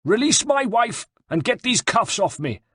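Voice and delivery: high, angry demand; snarled demand. A narrator's reading. Captive general demands his wife's release, snarling. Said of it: "snarled Bartolomeo".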